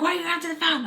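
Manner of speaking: lightly voiced mom asking why she answered; imitating mom's scolding. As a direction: snap at her like an annoyed mom.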